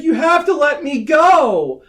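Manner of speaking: high, loud, drawn-out movie line; hammed-up heroic cry, slow and high; mock dramatic plea. Younger sister to older from the balcony, mock dramatic plea.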